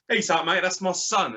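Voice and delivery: a dad telling off the fan beside him; old bloke objecting. Retold or imitated. Old dad telling fan off about his son, mock indignant. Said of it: "the old bloke next to me goes".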